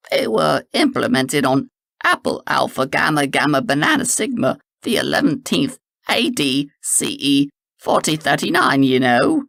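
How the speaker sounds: a hint of a brisk official inspector; Professor Green voice. A narrator's reading. Green bluffing the constable with official jargon. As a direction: rattle off the fake jargon with confidence.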